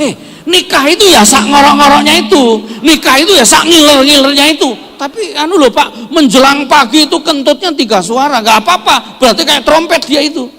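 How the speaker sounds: loud, high, fiery sermon delivery; full-force preaching. Acted. Pastor loudly exhorts husbands to accept their wives, preaching hard. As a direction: preach it out to the whole hall.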